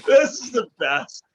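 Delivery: high delighted laughing words. Real, not acted.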